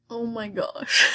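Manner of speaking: ordinary delivery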